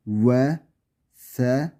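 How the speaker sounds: usual speaking voice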